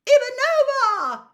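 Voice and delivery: high, loud booming announcer voice; stadium announcer. A narrator's reading. Announcer calling player names.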